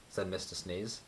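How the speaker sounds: ordinary delivery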